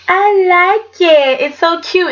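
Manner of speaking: drawn out and gushing; gushing delight. Real, not acted.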